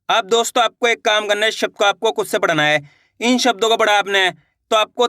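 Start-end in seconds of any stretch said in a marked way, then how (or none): none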